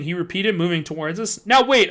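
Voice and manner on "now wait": high loud urgent "Now wait"; cry out. Acted. Kid shielding himself from the man, urgent loud cry. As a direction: throw up a warning cry. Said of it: "I cried".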